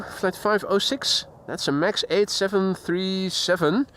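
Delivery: ordinary talk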